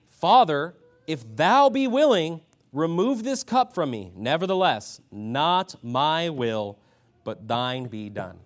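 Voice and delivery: slow, ringing Bible quotation; preacher proclaiming a solemn verse; emphatic scripture reading. A narrator's reading. Declaims scripture in Jesus' words.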